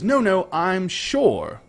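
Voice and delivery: questioning. Acted. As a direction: assure the guards while pointedly questioning. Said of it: "looking directly at Radovan questioningly".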